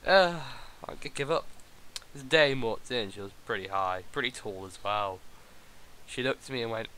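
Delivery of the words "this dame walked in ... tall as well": noir detective. Acted. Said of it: "a man there who looks like a detective".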